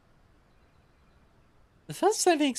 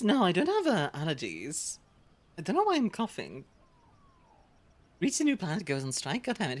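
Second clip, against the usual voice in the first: ordinary talk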